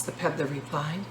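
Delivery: usual speaking voice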